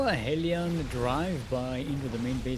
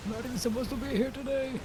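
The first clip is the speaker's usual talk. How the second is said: high whiny meme voice; 10 semitones higher, faster than usual. Pretend. Quoting the meme in unit voice.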